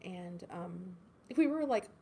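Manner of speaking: usual speaking voice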